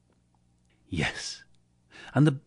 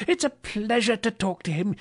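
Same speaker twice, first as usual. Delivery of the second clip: high, gushing pleasure; elated admiration; delighted Scrooge; 14 semitones higher than usual. A narrator's reading. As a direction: gush happily, pitched way up. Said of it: "said Scrooge".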